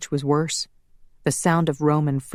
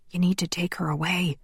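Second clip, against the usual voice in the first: softer, pressing whisper; urgent whisper; 3 semitones higher, 4 dB quieter than usual. A narrator's reading. Laszlo telling Connor to remove the woman, a whisper. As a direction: urge him quietly. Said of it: "Laszlo whispered".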